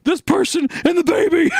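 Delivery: a high, strained yell with a breaking voice; screaming. Retold or imitated. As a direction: yell it at her mom in a panic. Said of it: "screaming at her mom".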